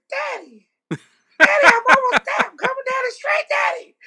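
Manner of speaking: very high-pitched imitated scream; shrieky put-on phone yell; mock screaming. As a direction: holler it high, like a friend on speakerphone. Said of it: "And she's screaming".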